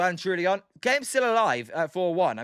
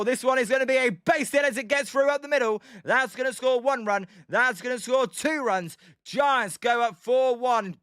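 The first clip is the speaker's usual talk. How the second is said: raised, building call of the hit as the runs score; excited play-by-play; 4 semitones higher than usual. Acted. Streamer calling the Giants' hit live for chat, excited play-by-play. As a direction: sell the base hit like a live broadcaster.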